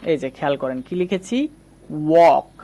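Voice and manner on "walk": ওয়াক with an open AW vowel, "wawk"; correct pronunciation. Shown as a demonstration. Teacher models the English word for learners, correct pronunciation. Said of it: "এটা হচ্ছে সঠিক উচ্চারণ".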